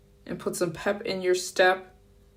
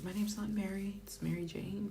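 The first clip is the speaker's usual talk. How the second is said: softer whisper, under her breath; whispered complaint; 3 semitones higher, 9 dB quieter than usual. Retold or imitated. Grumbling privately about being called Mary, whispered. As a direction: mutter it to yourself so nobody hears. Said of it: "I'm like".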